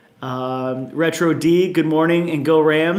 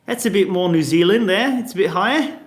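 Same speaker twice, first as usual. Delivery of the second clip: put-on playful higher-pitched New Zealand accent; 5 semitones higher than usual. Streamer showing viewers the difference in a playful New Zealand accent. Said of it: "It always sounds like I'm making fun".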